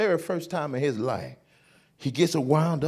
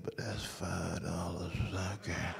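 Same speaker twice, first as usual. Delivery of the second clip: soft, slow, stingy refusal; quiet refusal; 11 semitones lower, 16 dB quieter, slower than usual. Retold or imitated. Dad to the Home Depot lady, refusing quietly. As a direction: refuse under your breath, slowly.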